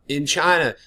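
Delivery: ordinary talk, nothing marked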